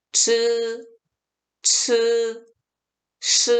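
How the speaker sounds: 즈, 츠, 시 held level without curling the tongue; wrong, uncurled retroflexes. Shown as a demonstration. Teacher shows students' mistake, uncurled 즈, 츠, 시. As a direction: leave the tongue flat on 즈, 츠, 시. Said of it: "보도에 틀렸어요".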